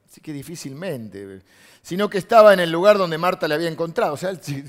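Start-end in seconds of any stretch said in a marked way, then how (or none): 1.8-4.1 loud, raised Bible reading; emphatic scripture reading. Acted. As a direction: proclaim the verse to the congregation.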